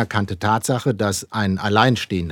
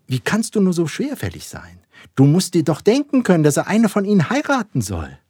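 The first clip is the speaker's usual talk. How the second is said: higher, indignant Mrs Bennet; exasperated wife; 8 semitones higher than usual. A narrator's reading. Wife scolds slow husband. (empört und ungeduldig)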